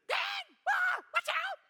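piercing high shriek of alarm; shrieking wife. Retold or imitated. Husband imitating his wife shrieking in the car. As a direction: shriek it like a scared passenger. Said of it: "She shrieks all the time".

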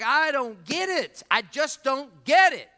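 high, loud, baffled outburst; mad, exasperated. Acted. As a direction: act out a sore loser's angry bafflement. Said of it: "And he was so mad".

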